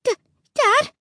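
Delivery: high, halting stammer. A narrator's reading. Frightened child calls to Dad, stammering. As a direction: stumble on the first sound, voice rising. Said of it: "I finally managed to stammer".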